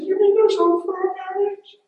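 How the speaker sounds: very high, loud squeaky question; imitated tearful wife. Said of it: "and she said".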